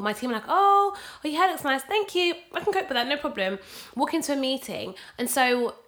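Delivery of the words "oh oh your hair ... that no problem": gushing colleague. Retold or imitated. Colleague gushing over hair.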